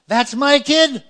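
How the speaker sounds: high, loud proud parent; a mother boasting about her kid. Retold or imitated. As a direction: shout it out like a proud mom.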